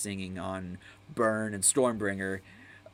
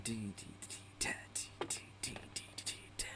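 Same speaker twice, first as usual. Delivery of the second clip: drum beat mimic; 17 dB quieter, faster than usual. Mimicking drums.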